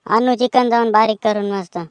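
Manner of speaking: ordinary delivery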